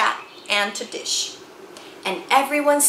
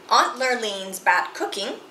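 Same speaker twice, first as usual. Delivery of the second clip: plain reading voice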